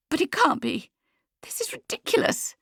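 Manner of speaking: very high, breath-filled disbelief; incredulous. A narrator's reading. Lucia doubting the news on the phone, incredulous. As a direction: protest in a high half-whisper.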